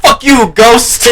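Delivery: very loud, high movie-quote shout; mock yell. Friend quoting a funny movie line, mock yell. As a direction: blurt it at full volume, high-pitched.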